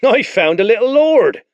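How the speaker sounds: high, loud mocking announcement; gloating spearman. Spearman announces the boy to his captain, gloating. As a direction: crow over your catch. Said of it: "the spearman announced".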